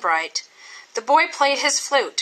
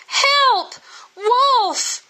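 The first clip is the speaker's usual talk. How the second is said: high, loud desperate shout; boy shouting; 3 dB louder than usual. Acted. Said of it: "he shouted".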